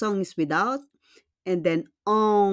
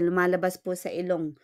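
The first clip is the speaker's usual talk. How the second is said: ordinary talk, nothing marked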